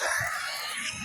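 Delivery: a short breathy burst of laughter; laughing at the absurdity of being ditched; disbelieving laugh. Real, not acted. Laughs at being left behind.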